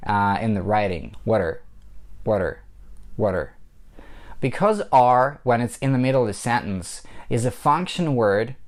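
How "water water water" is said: "water" as what and are run together; blended words. Shown as a demonstration. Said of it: "what you hear is not necessarily what you would see".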